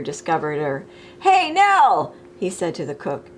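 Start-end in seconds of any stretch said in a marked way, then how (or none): 1.2-2.1 calling out. Acted. Waiter calling to cook.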